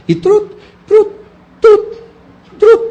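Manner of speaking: high, loud "trut, trut" like hitting a ball; volleyball smack noise. Retold or imitated. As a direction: pop each trut up like a volleyball pass. Mimics volleyball hits on truth.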